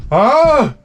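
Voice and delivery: high, dopey mock huh; dazed dad grunt. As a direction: play the drunk dad, clueless. Drunk dad voiced.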